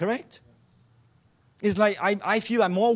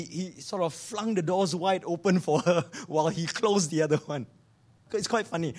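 ordinary talk, nothing marked

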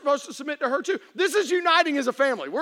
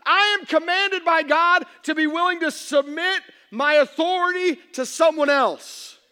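normal talking voice

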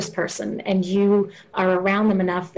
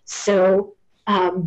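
ordinary talk

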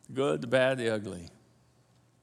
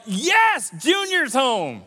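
mock joy; 5 semitones higher, 3 dB louder, slower than usual. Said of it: "wasn't like".

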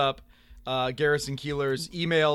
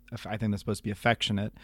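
ordinary talk, nothing marked

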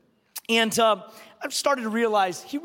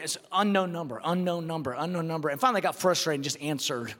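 ordinary talk

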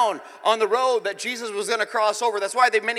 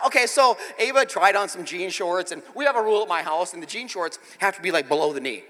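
ordinary talk, nothing marked

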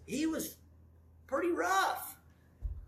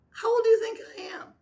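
quick, high teasing question; 13 semitones higher, much faster than usual. Retold or imitated.

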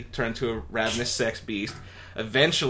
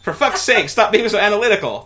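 louder, higher, fast irritated outburst; exasperated Lynn; 10 semitones higher, 10 dB louder than usual. Acted. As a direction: snap at him to stop analysing. Lynn snaps at Alf.

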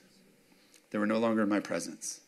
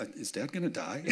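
small, softer voice, hesitant and worried; mock a worried kid, hushed and halting; child's hushed alarm, acted out; imitated panicked child; 9 dB quieter than usual. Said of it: "a panic".

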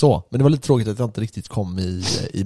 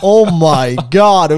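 shocked; 5 semitones higher, 6 dB louder, much slower than usual. Real, not acted. Friend reacts to being left behind.

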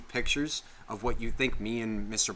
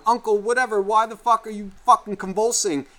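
put-on higher alarmed kid voice; cousin; 9 semitones higher, 5 dB louder than usual. Host mimics cousin alarmed at convulsing uncle. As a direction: play the panicked cousin. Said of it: "cousin's sitting there and going".

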